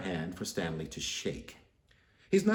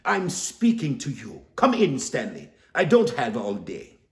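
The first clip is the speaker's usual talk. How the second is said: high, loud bossy shout; loud accented yell; 6 semitones higher, 7 dB louder than usual. Acted. Psychic lady shouting at Stanley to come in, loud and bossy. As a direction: bark it across the street. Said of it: "yells in a thick accent".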